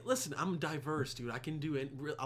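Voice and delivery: ordinary talk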